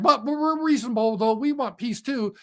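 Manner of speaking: high, whiny pretend-reasonable voice; mock innocent plea. Europe and Ukraine pleading they want peace, mock whiny voice. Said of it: "Ukraine and Europe are like".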